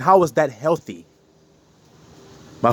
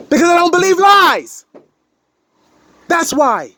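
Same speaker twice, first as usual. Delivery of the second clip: high, loud declaration; emphatic shout; 11 semitones higher, 6 dB louder than usual. Real, not acted. Speaker defends herself to critics, loud and high. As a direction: belt it out as a firm stance.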